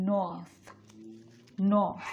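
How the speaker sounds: "নর্থ" as a long "nawth", no r; silent r. Shown as a demonstration. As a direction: model "নর্থ" slowly, dropping the r. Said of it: "এই জন্য এটা উচ্চারণ হবে".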